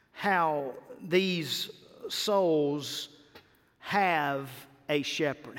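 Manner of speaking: usual speaking voice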